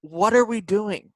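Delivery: high, drawn-out exasperated outcry; Taylor Twellman impersonation. Real, not acted. Podcaster despairing over the Knicks, exasperated. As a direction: plead slowly in total exasperation. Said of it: "do your Taylor Twellman impersonation".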